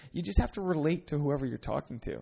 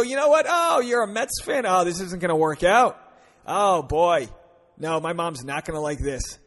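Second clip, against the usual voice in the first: mock dismay; 3 semitones higher, 7 dB louder than usual. Shown as a demonstration. Guy teases the Mets fan girl, mock dismay. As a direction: ham up teasing despair. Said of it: "No, you go".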